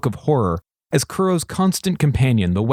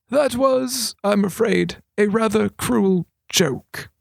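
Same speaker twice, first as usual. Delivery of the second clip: sheepish admission; 9 semitones higher, slower than usual. A narrator's reading. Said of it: "he said sheepishly".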